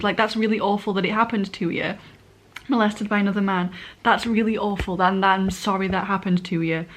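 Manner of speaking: normal talking voice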